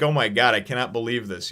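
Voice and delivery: normal talking voice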